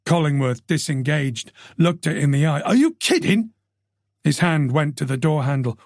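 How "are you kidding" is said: high, sharp question; can't believe it; startled colleague; shocked disbelief. Acted. Collingworth shocked by news.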